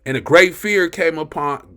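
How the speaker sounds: worked-up preacher reading scripture; amped-up reading. Real, not acted. Preacher reading of great fear to congregation, still amped up. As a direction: read it keyed up, pushing forward. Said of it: "I get so amped up about the word".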